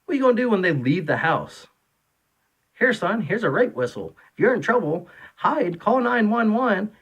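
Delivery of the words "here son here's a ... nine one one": high, sarcastic parent voice; mock coddling parent. Mocking soft parenting advice.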